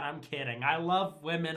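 normal talking voice

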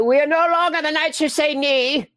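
high, loud silly knight voice; Monty Python knight voice. Retold or imitated. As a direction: boom it like a pompous comic knight.

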